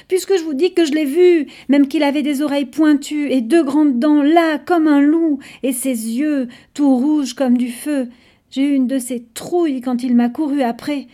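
high, loud and fast, getting worked up; frustrated child insisting; upset insisting. Acted. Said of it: "Antoine s'énerve".

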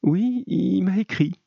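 high, slow, unsure woman's voice; slow, uneasy reply pitched high; hesitant lie. A narrator's reading. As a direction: lie timidly, dragging the answer out. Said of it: "Donc elle osa mentir".

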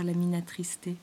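ordinary delivery

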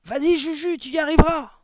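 loud, high rallying yell; shouted cheer. A narrator's reading. Someone in the crowd cheers the boy on, shouting. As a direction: cheer on your champion at full voice. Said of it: "quelqu'un cria".

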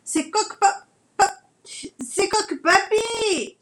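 high panicked grandpa rooster; old rooster voice. Acted. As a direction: stammer and fuss, hanging upside down. Grandfather rooster hanging upside down.

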